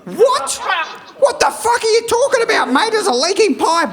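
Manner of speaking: mock furious customer; outraged shout. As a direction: yell at the plumber down the phone. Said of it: "Terry shocked at plumber".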